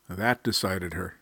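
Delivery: usual speaking voice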